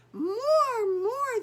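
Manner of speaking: crowd shouting. Acted. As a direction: cheer like a crowd demanding an encore. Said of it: "shoppers called out".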